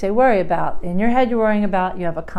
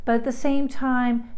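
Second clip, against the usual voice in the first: ordinary delivery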